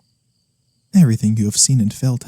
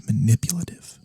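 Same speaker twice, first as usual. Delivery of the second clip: whispered word; 5 dB quieter than usual. Acted. Incubus repeats the found word.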